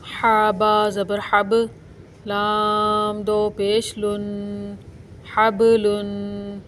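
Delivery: slow, drawn-out syllable spelling; spelling recitation. Shown as a demonstration. Teacher spells out the word letter by letter, slow and drawn out. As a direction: spell each letter out slowly, like a chant. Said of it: "जैसे मैं आपको पढ़कर अभी बताती हूँ".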